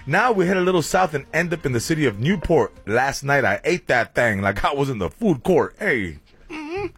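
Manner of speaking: rhyming radio weather bit over music; punchy DJ weather rap; hype weather read. Acted. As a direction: sell the forecast like a party announcer. Host performs rhyming weather report.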